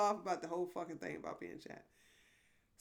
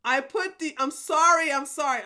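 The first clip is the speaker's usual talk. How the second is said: usual speaking voice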